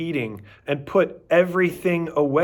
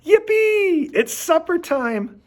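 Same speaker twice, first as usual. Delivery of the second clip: excited yippee; 4 dB louder than usual. A narrator's reading. Dog excited about supper.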